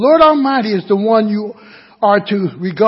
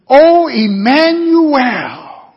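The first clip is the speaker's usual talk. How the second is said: loud, long drawn-out cry; 5 semitones higher, 4 dB louder, much slower than usual. Acted. Preacher voicing troubled people calling on God, crying out. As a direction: call it out slowly to God. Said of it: "that they cry out".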